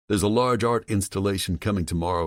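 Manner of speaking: usual speaking voice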